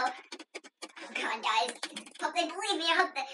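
barely voiced mock fear; hushed dread. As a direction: murmur anxiously to the viewers. Nervous wait after being spotted.

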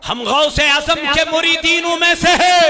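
loud, high shouted storytelling; fiery sermon climax; preaching at full force. Acted. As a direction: thunder out the saint's victory over the devil.

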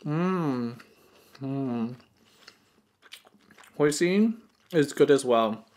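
talking while chewing food; muffled by mouthful of food; mouth full while chewing. Real, not acted. Seen 1: Bites roll at -2, cheeks full and chewing throughout words.

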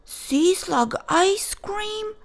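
high, slow and eager; a young shark asking with wide eyes; eager kid shark. Acted. Clark asking about the prize, high and eager.

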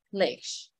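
low, soft Lish as an unstressed ending; falling unstressed syllable. Shown as a demonstration. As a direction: let Lish sink down in pitch. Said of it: "Giảm xuống huyền nặng".

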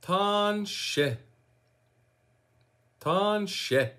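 Tanshe said carefully, TAHN-SHEH, first syllable held; teaching pronunciation. Shown as a demonstration. Teacher showing viewers hello in Michif, Tanshe as careful TAHN-SHEH. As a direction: model "Tanshe" for learners, two drawn-out syllables. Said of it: "try to say that with me".

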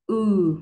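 Ừ held long with rounded lips pushed forward; long clear u. Shown as a demonstration. Teacher modelling a long rounded Ừ for students. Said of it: "kéo dài âm một chút xíu để cho nó rõ âm u".

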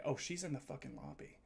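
ordinary talk, nothing marked

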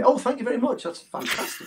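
high, breezy thank-you; a light parody of an overly casual reply; mock polite thanks. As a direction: toss off a mock cheerful thanks. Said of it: "you can't just say".

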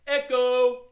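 high, loud echo call. Shown as a demonstration. Host practises the echo part with kids, loud call. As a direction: ring it out as the first call. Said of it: "All right, let's practice".

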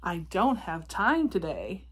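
ordinary delivery

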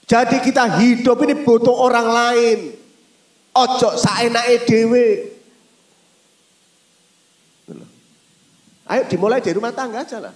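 loud, high climax; fervent preaching. Real, not acted. Preacher exhorting everyone to care for others, loud. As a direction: bellow it to the crowd.

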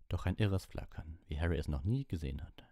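usual speaking voice

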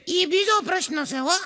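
put-on high cheeky boy, laughing; child voice. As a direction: dodge smartly like a kid. Boy answers teacher. (શિક્ષકને ટાળતો છોકરો, ઊંચા અવાજે હસતાં)

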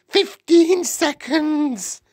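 very high, loud, stretched shriek; witch crying out. A narrator's reading. Witch counting down to the transformation, crying out. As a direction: screech the countdown slowly. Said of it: "cried the Grand High Witch".

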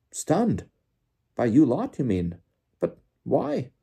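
high-pitched baffled questions; incredulous, swooping high voice; Bagman astonished. A narrator's reading. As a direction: yelp each question in surprise.

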